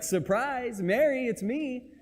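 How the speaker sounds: spring the surprise gently on a friend; playful surprise. Retold or imitated. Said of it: "sweet moment where it's like".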